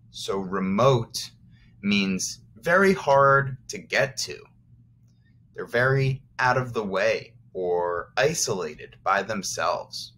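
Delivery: ordinary talk, nothing marked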